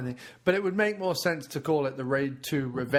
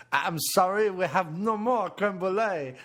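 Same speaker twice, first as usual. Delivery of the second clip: higher-pitched, apologetic French waiter; 5 semitones higher than usual. Pretend. Waiter refusing the customer creme brulee, hammed-up French accent. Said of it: "where the waiter comes out and goes".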